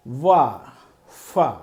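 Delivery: وا then فا from parted lips. Shown as a demonstration. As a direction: open the lips for وا, فا. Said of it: "واو اور فے کی آواز آئے گی".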